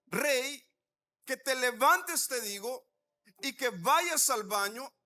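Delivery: loud, slow, emphatic order; retold divine order, hammering the key words; insistent command. God orders a reluctant man to get up, insistent command.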